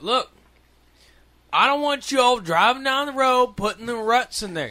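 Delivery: put-on old neighbor shouting down kids on quads, loud and high; cranky old neighbor. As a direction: bark it like a cranky neighbor chasing kids off his road. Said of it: "he's all like".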